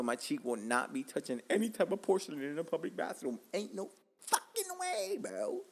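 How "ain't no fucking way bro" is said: very high, stretched-out comic protest; incredulous refusal. Real, not acted. Comedian refusing to touch public toilet porcelain, high drawn-out protest. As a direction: squeal the refusal slowly.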